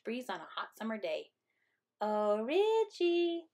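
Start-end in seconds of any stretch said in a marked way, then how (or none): 2.0-3.5 high, drawn-out sugary singsong; cooing. A narrator's reading. Sister luring brother to the rhubarb, sweet coo. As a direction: coo it slowly, luring him in. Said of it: "I cooed".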